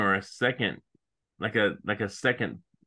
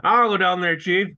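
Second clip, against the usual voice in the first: loud, high eager reporter voice; 6 semitones higher, 6 dB louder than usual. Retold or imitated. Co-host mocking a useless reporter volunteering to the chief, loudly. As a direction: belt it like a keen cub reporter. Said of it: "who's gonna be like".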